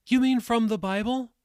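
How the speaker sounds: put-on high-pitched child's question; questioning boy. A narrator's reading. Jacob asks the elderly woman, high questioning boy voice. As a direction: ask it as a young boy, voice lifted high.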